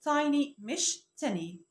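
Tiny said slowly, contrasted with the wrong tiny; slow correct vs wrong. Shown as a demonstration. A teacher contrasts right and wrong tiny for viewers. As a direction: spell out Tiny against the mistake, slowly. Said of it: "النطق الصح بتاعها".

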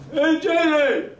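high holler to get someone's attention; imitated calling out. Stranger hailing the guitarist in the station, calling out.

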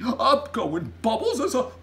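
loud, high, spluttering giant; big aghast outburst; giant crying out. A narrator's reading. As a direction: declare it a total calamity. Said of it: "cried the B F G".

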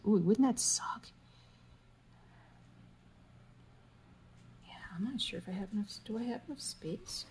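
soft fast fretting whisper; worried whisper. Real, not acted. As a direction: whisper your worry to yourself. Worried whispered self-talk.

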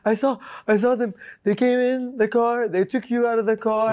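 high, mildly shaken retelling; a hint of the scared girlfriend; frightened girlfriend. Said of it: "She's like".

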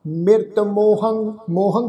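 drawn-out, syllable by syllable scripture reading; slow recitation. Shown as a demonstration. Teacher reciting the hymn slowly for the congregation. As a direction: lay each syllable down slowly and reverently. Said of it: "ਆਓ ਉਚਾਰਨ ਕਰੀਏ".

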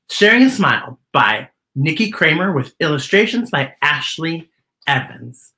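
ordinary delivery, nothing marked

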